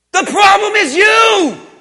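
loud, high, drawn-out declaration; emphatic shout. Acted. Preacher answering the baffled listener, emphatic shout. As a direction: shout it slowly, driving it home.

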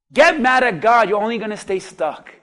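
usual speaking voice

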